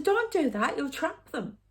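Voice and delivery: put-on high, loud cry of a little girl warning her brother; child's warning cry. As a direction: call out to stop him, pitched high. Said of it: "I said".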